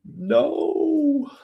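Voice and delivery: high, loud wail of dismay; anguished no. Real, not acted. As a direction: cry out at the missed trade. Missed the trade.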